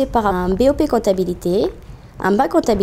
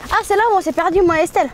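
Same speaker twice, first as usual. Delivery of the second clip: surprised recognition; 9 semitones higher, much faster than usual. Real, not acted. Recognises the hill where she got lost.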